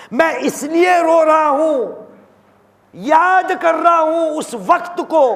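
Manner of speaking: high, ringing retold plea; fervent high-pitched preaching; impassioned lament. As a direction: proclaim the Imam's grief with fervour. Impassioned retelling of Imam's lament.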